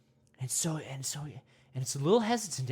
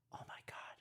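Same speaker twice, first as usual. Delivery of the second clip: hushed amazement; awed whisper; 4 semitones lower, 16 dB quieter than usual. Pretend. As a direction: gasp then whisper in awe. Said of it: "gasps whisper".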